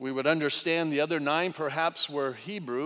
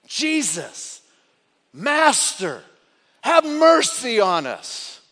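loud, higher pleading cry; lifted voices; 5 semitones higher, 8 dB louder, slower than usual. Retold or imitated. Lepers calling to Jesus, loud plea. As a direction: cry out for mercy from afar. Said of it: "they lifted their voices and said".

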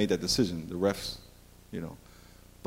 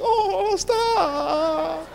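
put-on a coy high girlfriend voice; coy protest. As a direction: squeal it like she's pleased. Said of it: "She's like".

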